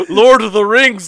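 usual speaking voice

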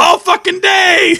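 high, slow yell; shouting. Acted. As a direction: hammer it out, loud and high. Character yelling insistently.

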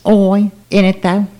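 hammed-up British "Oi, in it though" with high, drawn-out vowels; mock British accent. Mocking British reality-show talk.

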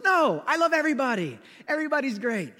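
high, insistent mock sincerity; overeager denial. Young pastor denies any staff conflict to his boss, overeager denial.

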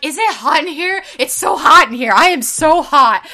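a hammed-up complaint of being too hot; mock overheated Eddie. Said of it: "And he's like".

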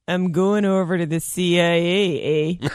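slow Canadian bit-part actor; deliberate Canadian lilt, tagged with eh; Canadian accent. Acted. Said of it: "sneak in the smaller parts as Canadians".

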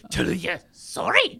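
imitated monster demand. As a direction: demand it in a hammed-up character voice. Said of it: "Yeah, the way she says".

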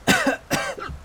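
high, strained coughing fit; choking cough. Acted. Hunter punched in the gut, coughing. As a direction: cough and gag as if winded.

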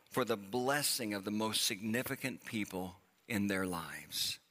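ordinary delivery

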